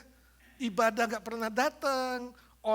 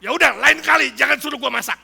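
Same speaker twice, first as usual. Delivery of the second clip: loud, much higher huffy retort; offended outburst; 5 semitones higher, 13 dB louder, faster than usual. Retold or imitated. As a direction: snap back, stung by the criticism. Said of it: "Marah dia".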